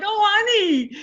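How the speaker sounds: high, swooning "oh, honey"; delighted coo. Retold or imitated. Wife swooning at husband folding laundry, high delighted coo.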